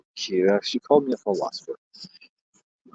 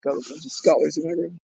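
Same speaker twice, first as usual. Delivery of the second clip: usual speaking voice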